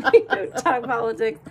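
repeating it through real laughter; laughing. Real, not acted. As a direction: say it again while still laughing. Repeats the rule while laughing.

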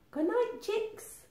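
lilting, hushed good night; soft high goodnight. Acted. Mother Hen saying good night to chicks, soft and high. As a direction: tuck them in with a high gentle voice.

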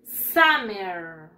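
SUMMER with a long S, low and falling; model pronunciation. Shown as a demonstration. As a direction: stretch "Summer" out for pupils to copy. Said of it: "Repeat after me". (pronunciación modelo, alargada y grave)

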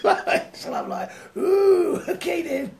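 giggling through the reply, pitch jumping up; laughing. Real, not acted. Preacher chuckling as he retells God's order, laughing. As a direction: laugh while saying it.

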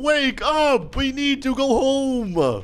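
high, drawn-out pleading cry; urgent wake-up call. Acted. Player waking the sleeping kid to escape, urgent.

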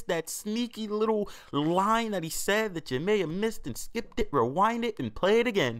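high, loud, excited mock outrage. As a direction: shout it up high like breaking news. Mock outrage rewinding clip.

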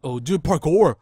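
ordinary delivery

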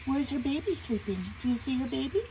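ordinary delivery, nothing marked

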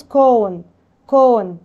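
كون with a drawn-out "oh" as in cone; long O demo. Shown as a demonstration. Teacher modelling long O for pupils, held "oh" in كون. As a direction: stretch the "oh" in كون. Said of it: "اللونج او".

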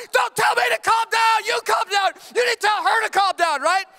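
high, loud, slow angry comeback; husband snapping back at his wife in a mock fight; heated retort. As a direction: fire it right back at her.

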